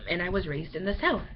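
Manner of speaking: usual speaking voice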